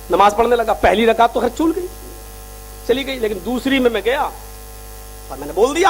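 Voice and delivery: normal talking voice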